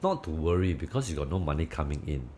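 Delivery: ordinary talk, nothing marked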